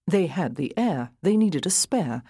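ordinary delivery

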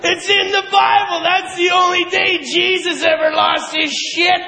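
ordinary talk, nothing marked

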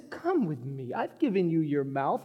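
low, soft reply after the outburst; God answering gently. Acted. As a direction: answer the protest quietly and low. God answers Moses' protest.

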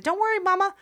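put-on the favourite child showing off; eager good daughter.

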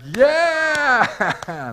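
high, loud mock roar of rage; mock angry yell. Pastor mocking an angry God the Father, hammed-up yell. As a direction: bellow it like an enraged dad. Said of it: "God the Father ... being like".

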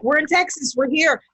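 high-pitched mock excitement; excited, imitated. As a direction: imitate friends thrilled to have arrived. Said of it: "they were so excited".

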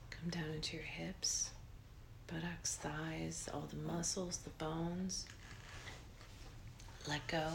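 breathy guided relaxation, unhurried; soft spa whisper. Acted. Leads a guided meditation.

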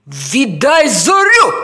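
slow, loud, barked command; growled-out, forceful order; menacing dog bark. A narrator's reading. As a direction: demand it like a threatening dog. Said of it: "грізно гавкнув Бровко".

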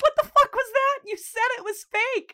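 very high, loud squawk; freaked-out protest. Retold or imitated. Boy confronting partner after gunshot, freaked-out protest. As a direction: shriek the accusation in mock panic.

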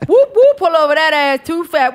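high whooping siren into a loud bark; police siren imitation. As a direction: wail like a cop siren, then yell the order. Mock police siren call.

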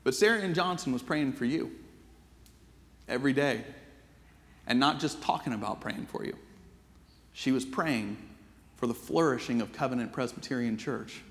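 hushed, heartfelt sermon ending; quiet sermon close. Real, not acted. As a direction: let each line land gently before the congregation. Quiet closing sermon reflection.

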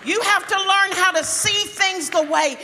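high, loud pulpit proclamation; full-force preaching. Real, not acted. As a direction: preach it out to the whole congregation. Preacher exhorts the congregation.

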